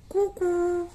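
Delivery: high cuckoo coo for pupils; cuckoo call. Shown as a demonstration. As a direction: model the call for the class to repeat. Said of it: "पहले सुनो मैं कैसे बोल रही हूँ". (बच्चों के लिए ऊँची कूक)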